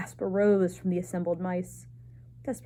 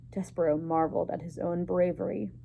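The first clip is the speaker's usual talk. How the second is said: ordinary delivery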